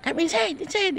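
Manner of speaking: high call to the dog. Retold or imitated. The maid calling the barking dog to come inside. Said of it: "Sinisigawan ko".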